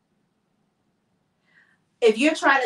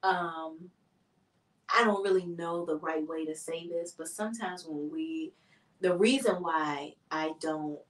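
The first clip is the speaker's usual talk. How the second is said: low, soft and hesitant; tactful, gentle delivery; 4 semitones lower, 7 dB quieter than usual. Shown as a demonstration. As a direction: ease carefully into delicate news. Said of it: "I would rather you just be like".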